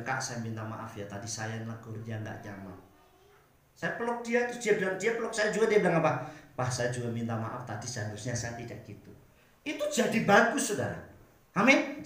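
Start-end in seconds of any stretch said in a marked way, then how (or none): 0.0-2.8 low, soft apologetic voice; gentle apology. Retold or imitated. Preacher apologises to Riel in his room, gentle and contrite. Retold private apology after scolding. 6.6-9.1 low, hushed apologetic reply; soft apology. Retold or imitated. Riel apologises back to the preacher, soft and contrite. Retold apology returned after a hug.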